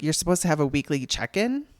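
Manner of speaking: "check-in?" lifted in a questioning rise at the end. Retold or imitated. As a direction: imitate her querying it, pitch climbing on "check-in". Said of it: "she said it like a question".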